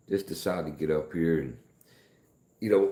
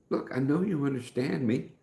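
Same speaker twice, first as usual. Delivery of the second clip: higher, pleading with a knowing push; exasperated insisting; 10 semitones higher than usual. Retold or imitated. Retelling pressing the neighbor who feigns no English. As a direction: call her bluff, half laughing. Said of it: "And I'm like".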